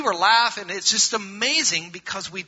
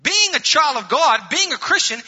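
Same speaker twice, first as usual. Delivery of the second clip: loud and high, pressing each phrase; raised pulpit voice; emphatic preaching; 9 semitones higher, 4 dB louder than usual. Real, not acted. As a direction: preach it out with fervor.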